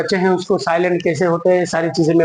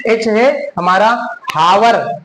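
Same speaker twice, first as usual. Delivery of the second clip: usual speaking voice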